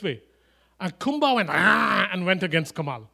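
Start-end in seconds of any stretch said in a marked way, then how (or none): 1.5-2.1 loud sudden "aah" of an attacking dog; imitated dog snarl. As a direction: mimic a protective dog lunging. Imitates dog lunging at Kamal.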